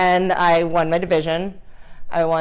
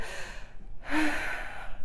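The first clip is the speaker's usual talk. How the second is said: overwhelmed sigh; 8 semitones higher, 12 dB quieter than usual. Retold or imitated. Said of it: "I was like".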